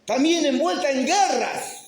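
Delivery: high, loud pulpit preaching; fiery preaching. Acted. Pastor preaching to the congregation about war, fiery preaching. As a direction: preach it out with rising fire. Preacher building to full force.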